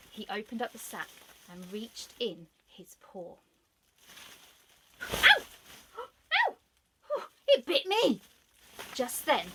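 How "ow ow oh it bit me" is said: very high, loud yelping cries; pained yelp. Acted. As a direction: yelp like a fox whose paw got bitten. Fox bitten by dog.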